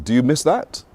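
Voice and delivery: ordinary talk, nothing marked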